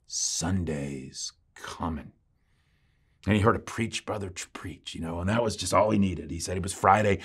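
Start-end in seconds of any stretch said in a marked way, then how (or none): 0.1-2.1 very slow, low and hushed refrain; slow start. Retold or imitated. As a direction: draw out each word softly like an old preacher. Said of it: "He started off slow".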